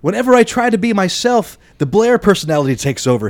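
high, hammed-up sobbing voice. A narrator's reading. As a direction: plead it as a mock sob. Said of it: "he sobbed".

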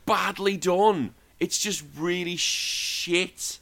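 high, slow scornful whine; slow high mocking disgust; disdainful drawl. Real, not acted. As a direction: stretch it out in contempt. Mocking the awkward scene.

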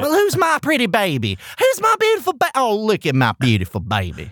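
high, gushing Texas pageant mom. Retold or imitated. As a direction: coo over the baby like a pageant mom. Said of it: "sounds like a mom from Texas who puts her daughter in pageants".

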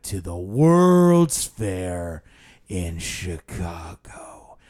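slow, drawn-out mock quizmaster; imitating a guide stretching for time; tour guide's delivery. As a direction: drag the question out, dropping at the end. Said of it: "this is how he talked".

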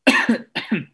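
sharp single cough; coughing fit. Real, not acted. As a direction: cough hard. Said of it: "Sorry if I keep coughing".

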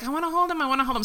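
put-on high eager kid voice; child voice. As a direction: whine like an excited boy. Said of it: "our son was like".

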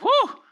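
high-pitched impressed whoop; quick high hoot of admiration; admiring "whoo". Retold or imitated. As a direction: imitate an awed onlooker's short whoo. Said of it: "people looked at him and were like".

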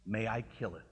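a low, soft, calm offer; quiet gentle question. Retold or imitated. Angel quietly asks the tormented man, soft and low.

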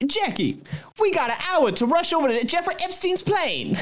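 very high excited yelp; Chris Tucker impression. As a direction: squeal it high and urgent. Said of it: "Chris Tucker is like".

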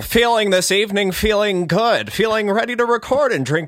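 cheesy host voice. Acted. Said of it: "Loving this character".

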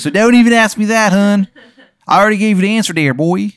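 high, loud, hammed-up Maryland accent; overdone Maryland accent, loud and high; terrible Maryland accent. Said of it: "His accent is so bad".

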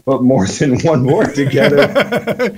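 bright laughing delight. Real, not acted. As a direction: laugh through the words.

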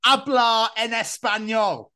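high, very slow mock Spanish; imitating Keris. Imitating a friend proudly trying Spanish. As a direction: ham up a friend's halting Spanish. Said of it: "Keris, bless her".

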